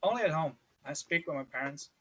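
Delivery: ordinary delivery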